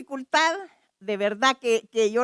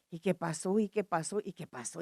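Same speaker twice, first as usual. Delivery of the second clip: low, dazed repeated questioning; quietly re-enacting disorientation; retold confusion; 6 semitones lower, 8 dB quieter than usual. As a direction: murmur it low, as if lost. Retells her dazed confusion after crying.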